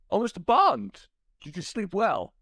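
hammed-up Walken villain line; Christopher Walken. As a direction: tease Bond like a smug Walken. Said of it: "Christopher Walken voice".

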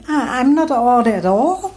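high, loud stammered protest; loud indignant cry. A narrator's reading. Emir to the frog god who called him odd, loud cry. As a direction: protest loudly, pitch leaping up. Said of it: "Emir cried loudly".

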